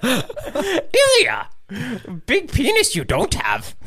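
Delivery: high, slow, strained puppet sage; Yoda. Acted. Friend mocking Ilya in a joke impression, high Yoda voice. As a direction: squeeze out a high creature voice, solemnly slow. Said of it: "Can you do a Yoda impression?".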